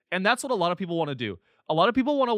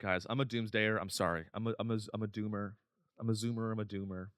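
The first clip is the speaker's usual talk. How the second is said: much softer, lower mumbled confession; sheepish mutter; 8 semitones lower, 10 dB quieter, faster than usual. Real, not acted. Comic to his audience, admitting he's a doomer, soft and low. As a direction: mumble it like a guilty admission.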